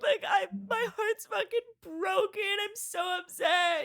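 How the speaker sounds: high, whiny, tearful pleading; mock drunk crying. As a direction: whimper it like a heartbroken drunk. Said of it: "I was like".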